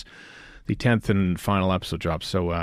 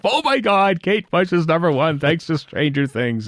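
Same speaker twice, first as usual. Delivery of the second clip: mock fan gush; 7 semitones higher than usual. Twitter fans' excited quote.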